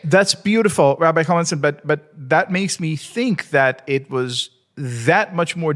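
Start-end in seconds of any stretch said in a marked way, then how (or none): none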